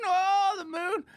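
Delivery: put-on very high, whiny little kid voice; excited child. Child crying to Daddy about going to the moon, high and whiny. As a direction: squeal like a kid begging Daddy.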